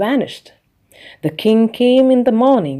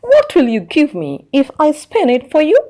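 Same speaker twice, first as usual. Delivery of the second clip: little man's bargain; 7 semitones higher, 3 dB louder than usual. A narrator's reading. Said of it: "asked the little man".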